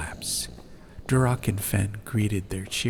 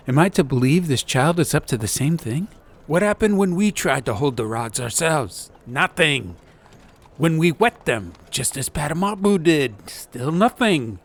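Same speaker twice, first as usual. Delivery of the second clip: louder, raised and urgent; agitated; 7 semitones higher, 6 dB louder than usual. Acted. Duroc arguing about the rods, louder and urgent. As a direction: press the point insistently.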